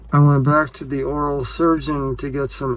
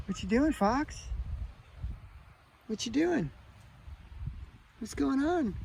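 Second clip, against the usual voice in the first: much higher sing-song coo to a pet; high cooing pet voice, softer; 14 semitones higher, 8 dB quieter than usual. Real, not acted. Talking to the pet fox.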